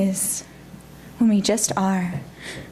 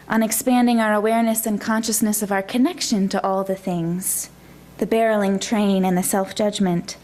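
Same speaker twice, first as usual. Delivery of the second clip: ordinary talk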